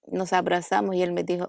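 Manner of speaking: usual speaking voice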